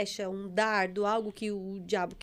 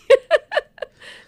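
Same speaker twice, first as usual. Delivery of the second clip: loud high laugh; 15 dB louder than usual. Real, not acted. Laughing at her own story with the pastor, loud high laugh.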